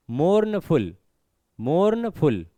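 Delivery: "मोर्नफुल" broken as morn-ful, both parts drawn out; split in two. Shown as a demonstration. Teacher shows how the word breaks, "मोर्नफुल" split into morn-ful. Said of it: "अब इसको तोड़ना पड़ रहा है".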